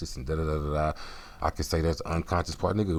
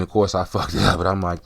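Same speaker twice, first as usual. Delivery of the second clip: ordinary talk, nothing marked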